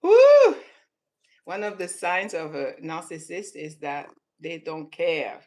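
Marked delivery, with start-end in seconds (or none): 0.0-0.6 a loud, very high whoop of delight; excited whoop. Real, not acted. Cheering out in delight.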